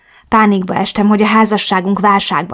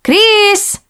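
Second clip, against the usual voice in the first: high, loud call of a name; 13 semitones higher, 4 dB louder than usual. Acted. Ellen calling for Chris through the flat, high and loud. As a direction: call her friend's name across the house. Friend calls the narrator's name.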